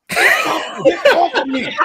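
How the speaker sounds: high, slow, laughing plea. Retold or imitated. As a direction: laugh through a mock plea. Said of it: "they be beating me off".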